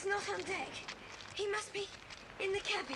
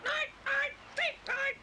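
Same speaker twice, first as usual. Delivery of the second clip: parrot mimic; 7 semitones higher, slower than usual. Parrot Kiki mimicking goodnight.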